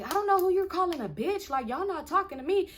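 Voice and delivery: high-pitched mock challenge; confrontational retort. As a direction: act out squaring up to the other woman. Acted retort in women's quarrel.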